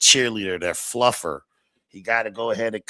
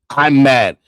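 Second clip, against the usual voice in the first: sarcastic loud; 4 semitones higher, 10 dB louder than usual. Pretend. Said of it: "You, I'm mad".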